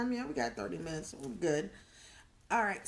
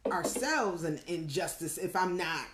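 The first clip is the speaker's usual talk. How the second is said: usual speaking voice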